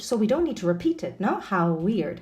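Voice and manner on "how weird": "How weird" joined with one single w; shared W sound. Shown as a demonstration. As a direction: slide How into weird without repeating the w. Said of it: "the W sound is shared".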